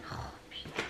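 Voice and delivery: real, barely voiced swearing to herself while searching; whispered swearing. Real, not acted. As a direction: curse under your breath. Ne retrouve pas ses étiquettes. (juron lâché tout bas)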